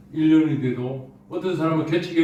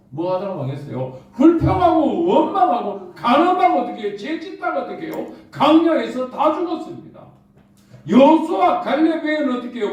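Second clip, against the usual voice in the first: raised, louder sermon voice calling out; fervent preaching; 10 semitones higher, 5 dB louder than usual. Acted. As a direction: preach it with rising fire. Preaching about the wilderness. (열정적인 설교)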